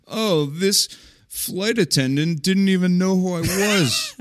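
high, slow complaining celebrity voice; mock the snubbed celebrity; whiny Tony Hawk impression. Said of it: "pose in pictures and be like".

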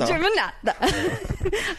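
Germanato stretched slow and high, Italian-style. Retold or imitated. Host echoes [name]'s name in an Italian accent. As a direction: roll Germanato out like [name]. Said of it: "Or Stephanie Germanato".

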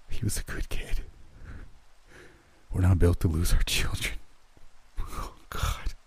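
acted breakdown, voice giving out; breaking down. A narrator's reading. Father mourns his lost son, breaking down. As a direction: let the voice collapse into voiceless grief. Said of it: "he broke down".

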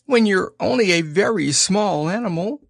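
high, a touch of sniffle; a small pig close to tears, understated; mild sniffling piglet; sniffly Piglet. A narrator's reading. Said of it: "sniffling slightly".

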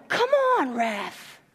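high, strained "Come on"; plead-yell at the ref; calling out at the ref. Retold or imitated. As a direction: mom calling out from the front row. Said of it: "and then I go".

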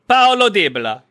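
high, punchy Italian accent; hammed-up Italian accent. Shown as a demonstration. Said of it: "Say it in an Italian accent".

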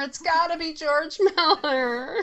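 high-pitched, insistent enthusiasm; excited outburst. Real, not acted. Film pundit blurting out her favorite director pick, excited. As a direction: jump in eagerly, pitched well up.

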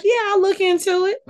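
high, sarcastic brush-off; high mock promise; mock dismissive reply. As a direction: toss it off in a high mocking voice. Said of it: "And I was like".

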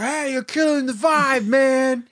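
very high, slow, whiny shout; mock angry singer. Lead singer angry at the dad onstage, comic angry shout. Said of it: "lead singer gets angry".

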